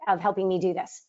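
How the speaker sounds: ordinary talk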